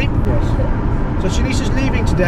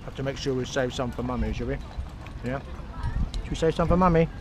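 ordinary talk, nothing marked

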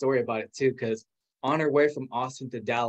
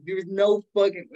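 stunned disbelief; 6 semitones higher, 4 dB louder than usual. Retold or imitated. Retold disbelief at meeting husband.

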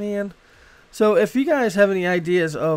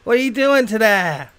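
loud, higher Boston accent with broad "ah" endings; 6 semitones higher, 7 dB louder than usual. Shown as a demonstration. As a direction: belt it out in exaggerated Bostonian. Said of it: "So everything's an ah".

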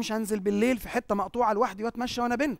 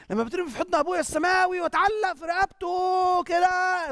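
fervent; 8 semitones higher than usual. Real, not acted. Preacher pictures the heavenly father.

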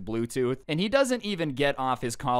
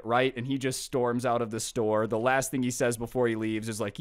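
normal talking voice

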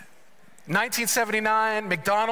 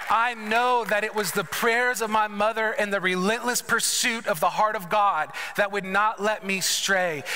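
normal talking voice

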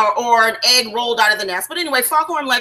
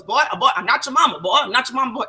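quick, lightly voiced blustering rooster; a touch of the cartoon rooster, rattled off fast; Foghorn Leghorn; much faster than usual. Voicing the rooster scolding the chick, quick light impression. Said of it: "And he goes".

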